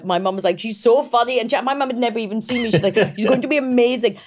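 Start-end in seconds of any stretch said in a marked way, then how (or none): 0.6-1.4 mum's proud gush in a bad Indian accent, pitched higher; a mocking imitation of an Indian mum, louder and higher; excited Indian mum in a deliberately bad accent. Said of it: "that's a very bad Indian accent". 3.1-4.2 mum's proud gush in a bad Indian accent, pitched higher; a mocking imitation of an Indian mum, louder and higher; excited Indian mum in a deliberately bad accent. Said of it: "that's a very bad Indian accent".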